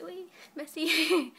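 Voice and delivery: slow, loud, high and drawn out; proud reply. Retold or imitated. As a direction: savour the thanks, very pleased. Her proud reply to examiner.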